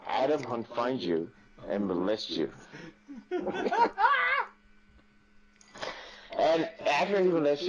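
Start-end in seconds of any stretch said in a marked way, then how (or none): 3.1-4.5 high cackling laugh. Real, not acted. Laughs at own joke.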